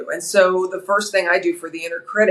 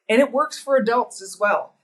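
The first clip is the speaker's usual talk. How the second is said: ordinary talk, nothing marked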